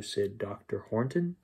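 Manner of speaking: usual speaking voice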